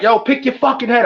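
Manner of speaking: high-pitched rallying holler; rally squad cheerleader. Retold or imitated. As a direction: rouse the team in a high, urgent voice. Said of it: "the cheering squad, the, the rally squad".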